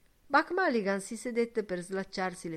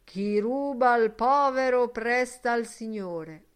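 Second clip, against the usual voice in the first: slow, raised, loud pronouncement; solemn; 5 semitones higher, 8 dB louder than usual. A narrator's reading. Mulligan erect with hands joined, pronouncing solemnly. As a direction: intone it slowly like scripture. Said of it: "disse solennemente".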